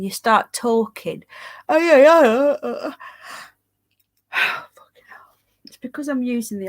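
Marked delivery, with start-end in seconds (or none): 1.7-2.9 words stretched by a big yawn. Real, not acted. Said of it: "And now I'm yawning". 4.3-5.4 breathless whisper at the end of a yawn; sighing yawn. Real, not acted. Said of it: "And now I'm yawning".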